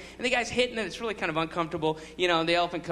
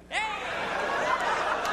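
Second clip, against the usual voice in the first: high, drawn-out echoing hey; calling out; 13 semitones higher than usual. Pretend. Comedian pretends to shout into a huge cavern, calling out. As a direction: call it high as into a cave.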